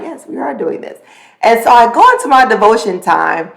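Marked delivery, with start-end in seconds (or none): 0.0-1.0 soft, hushed agreement; softly answering her own body's wake-up call; quiet reply to herself. Retold or imitated. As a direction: murmur it back to yourself. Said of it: "And I'm like".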